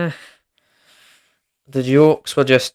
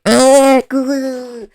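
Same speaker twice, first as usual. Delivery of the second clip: mock grunts; 12 semitones higher, 6 dB louder than usual. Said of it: "And he's like".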